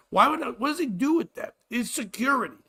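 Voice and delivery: ordinary talk, nothing marked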